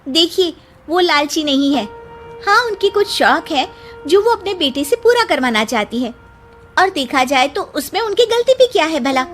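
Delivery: pointed daughter-in-law retort; indignant young bride. Acted. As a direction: answer the gossips back with polite indignation. Bride defends mother-in-law to gossips.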